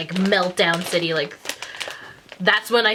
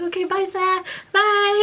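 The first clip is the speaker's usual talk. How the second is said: high, quick farewell as she bails; mock friend ducking out; friend bailing; 12 semitones higher than usual. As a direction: friend fleeing the scene, high voice. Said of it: "my friend was like".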